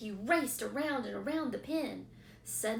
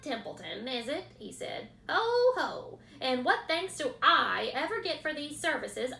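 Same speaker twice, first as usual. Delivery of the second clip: higher, louder mocking mimicry of the pig; mocking imitation; 5 semitones higher, 7 dB louder than usual. A narrator's reading. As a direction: rat parodying Wilbur. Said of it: "He began imitating Wilbur's voice".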